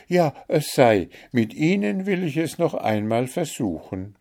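touched daughter taking heart; moved resolve. A narrator's reading. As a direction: resolve eagerly to try. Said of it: "rief Märchen bewegt aus".

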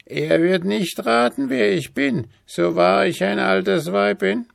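hohe, langsame, verschlagene Altweiberstimme; listige Alte. A narrator's reading. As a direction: lockend ein Angebot machen. Alte Frau erklärt den Plan. (hohe, listige Hexenstimme)

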